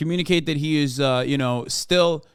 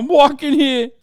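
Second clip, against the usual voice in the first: loud, high New York "I'm walking here"; New York accent; 11 semitones higher, 7 dB louder than usual. Pretend. Streamer joking in a loud, high New York accent. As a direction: bark it like a New York cabbie. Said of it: "wouldn't have that kind of accent".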